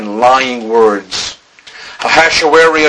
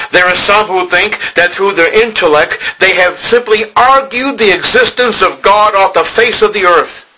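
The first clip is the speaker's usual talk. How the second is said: rising preaching; 7 semitones higher than usual. Acted. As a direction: build up the outrage at the pulpit. Preacher raising his voice.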